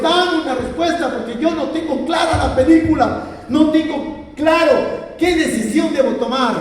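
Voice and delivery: high-pitched, insistent plea to God; desperate prayer. Retold or imitated. Man retells demanding God's answer, high impassioned prayer. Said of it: "Así le dije a Dios".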